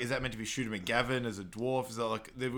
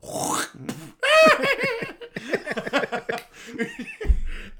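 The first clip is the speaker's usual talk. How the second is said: long shrill mocking cackle, very high; clown laugh; 9 dB louder than usual. The mini golf clown mocks Happy with a high cackle. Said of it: "you hear the clown go".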